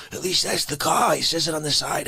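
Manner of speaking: normal talking voice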